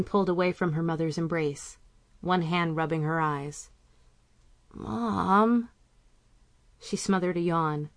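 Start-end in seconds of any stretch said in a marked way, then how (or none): 4.7-5.7 put-on higher, whiny teen girl voice; sleepy, put-upon teenager; groggy teen daughter.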